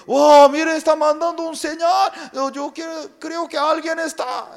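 high, loud excited call; excited spotting. Acted. Rescuers see the smoke signal, high loud excitement. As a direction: point it out, excited.

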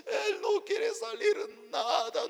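high, slow, tearful whine; mock crying. Wife coming out to the pastor again, mock crying. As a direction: wail it like a sobbing wife. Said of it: "Ella salía otra vez llorando".

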